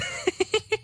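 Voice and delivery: hohes, leises Dämonenkichern; höhnisches Kichern. Acted. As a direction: kicher hoch und schadenfroh. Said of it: "Hör auf zu lachen". (Heinrich liegt gelähmt, Dämon kichert schadenfroh)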